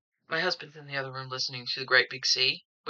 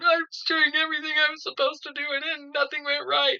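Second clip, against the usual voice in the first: high, whiny imitation; mock whining; 10 semitones higher than usual. Women crying in court over their excuses, high mock whine. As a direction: whine it like a crocodile-tears excuse. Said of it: "they're crying and they're whining".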